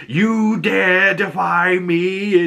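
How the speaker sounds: slow, high, eerie spirit voice; a spooky spirit's slow threat. Retold or imitated. External voiced again.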